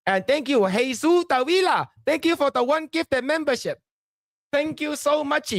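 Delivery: high, bright welcome to viewers; hyped streamer intro. Acted. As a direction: pump up the stream opening. Upbeat stream welcome and thanks.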